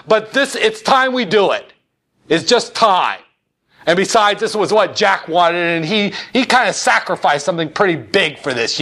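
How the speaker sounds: Johnson yelling. Retold or imitated. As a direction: hammer the senator into voting like LBJ. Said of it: "just screaming at him".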